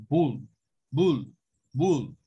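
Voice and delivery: "bull" with a short, relaxed U; short u demo. Shown as a demonstration. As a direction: clip the vowel in "bull". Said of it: "que no es este mismo sonido".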